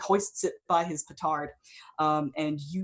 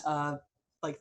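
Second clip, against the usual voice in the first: ordinary talk, nothing marked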